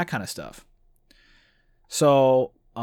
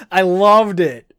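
laughing delight; 6 semitones higher, 10 dB louder, much slower than usual. Real, not acted. Laughs with delight after reading.

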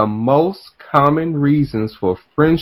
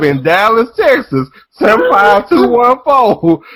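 emotional outcry; 9 semitones higher than usual. Real, not acted. Reliving the whooping, crying out.

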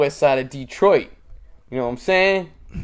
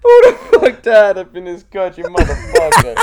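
louder, higher yell breaking into laughs; laughing mock yell; 6 semitones higher, 9 dB louder than usual. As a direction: yell it while cracking up. Quoting viral clip, laughing.

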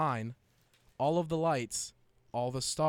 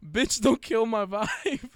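laughing loud; 7 semitones higher, 6 dB louder than usual. Real, not acted. Cracking up reading titles.

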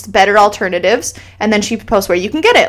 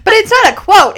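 emphatic protest; 10 semitones higher, 5 dB louder than usual. Real, not acted. Insisting after quoting herself.

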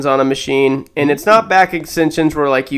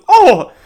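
high, loud "oh" of surprise; exaggerated yelp on impact; mock startled cry; 14 semitones higher, 6 dB louder than usual. Lady bumping into a gym machine, mock startled cry.

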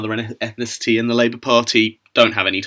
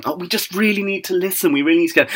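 much higher mocking voice; Lisa Nandy; 8 semitones higher, faster than usual. Critic answering as the questioned politician, high mocking imitation. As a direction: parody a politician's earnest pleading, pitched right up.

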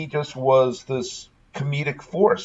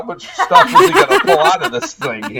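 laughing; 6 dB louder than usual. Real, not acted. Laughing at the endless jacket.

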